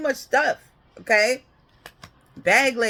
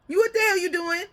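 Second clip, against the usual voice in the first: much higher, laughing exasperation; mock scolding; 10 semitones higher than usual. God scolding a wayward driver, higher and amused. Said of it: "he slapped your hand like".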